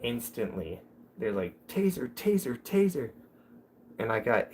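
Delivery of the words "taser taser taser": high, urgent imitation of officers calling out; rapid repeated warning, voice pitched way up; mock alarm call. Streamer imitating officers as he glides off, mock alarm.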